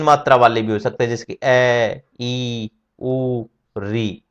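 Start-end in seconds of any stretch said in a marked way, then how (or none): 1.4-4.2 ए ई उ री drawn out very long, as if calling someone far off; very slow three-beat vowels; prolonged calling vowels. Shown as a demonstration. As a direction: call across a distance, holding each vowel. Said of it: "यदि दूर से बुलाना हो".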